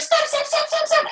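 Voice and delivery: high, rapid-fire mock alarm; squeaky, repeated command; urgent halting. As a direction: imitate staff frantically calling a halt. Retold staff urgently halting the push.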